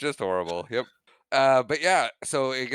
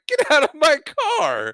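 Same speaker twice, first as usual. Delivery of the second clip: much higher, loud shout with a laugh; mock yell; 9 dB louder than usual. Young Biff finding an old man in his car, loud shout.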